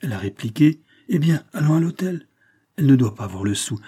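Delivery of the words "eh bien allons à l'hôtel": high-pitched, irritated quote; annoyed, imitated consent. A narrator's reading. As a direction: repeat her testy reply with raised pitch. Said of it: "du ton le plus irrité".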